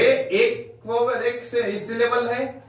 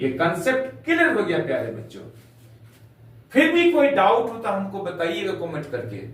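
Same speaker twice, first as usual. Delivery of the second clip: usual speaking voice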